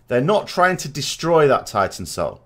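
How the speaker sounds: ordinary talk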